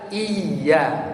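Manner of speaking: "iya" with a held, doubled y; "iya" said iy-ya, leaning on the y; pressed tasydid. Shown as a demonstration.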